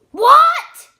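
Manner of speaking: very loud high "what"; loud shocked yell; shocked shout. Acted. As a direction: explode in disbelief. Dad shocked by son.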